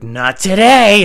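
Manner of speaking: loud, drawn-out high cry; defiant shout. Retold or imitated. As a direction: stretch it into a triumphant battle cry. Defiant line before ramming car.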